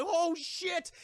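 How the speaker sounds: high-pitched mock dread; alarmed exclamation. The crew see Kong coming, high mock panic. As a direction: draw it out in acted alarm.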